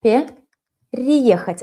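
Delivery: drawn-out Переехать; slow model word. Shown as a demonstration. Teacher highlights the perfective verb slowly. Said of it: "look how I used perfective verb".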